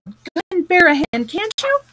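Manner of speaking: loud impatient call for help. A narrator's reading. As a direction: call him over, pitch climbing at the end. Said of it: "they called".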